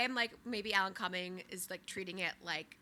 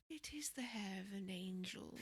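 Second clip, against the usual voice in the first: very soft, awed murmur; wide-eyed puppy dog; 13 dB quieter than usual. Acted. Admirer taking the order to look at her hair, hushed puppy-dog awe. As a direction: say it softly like a smitten puppy. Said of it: "wide-eyed puppy dog".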